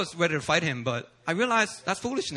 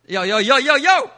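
loud, higher yo yo yo to get his attention; calling him out; 6 semitones higher, 8 dB louder than usual. Retold or imitated. The preacher confronting the CD seller, a loud call. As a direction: demand attention, louder and louder.

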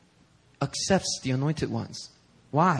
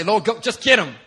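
loud, high, hammed-up plea to get him; mock vengeful prayer; 8 semitones higher, 7 dB louder than usual. To the congregation, mocking a vengeful prayer, loud and high. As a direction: pretend to beg God to strike him. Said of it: "If I say".

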